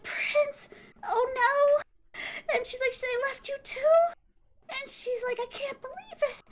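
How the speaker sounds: put-on high, tearful girl's voice; a small knight horrified to find her prince; upset girl. Girl finds betrayed prince.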